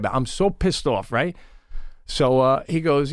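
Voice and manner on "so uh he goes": usual speaking voice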